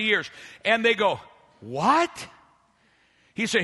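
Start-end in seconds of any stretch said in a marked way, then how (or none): 1.6-2.3 questioning, rising "what"; baffled disbelief. Acted. Preacher voicing Israelites hearing the law, baffled. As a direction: react as if it makes no sense.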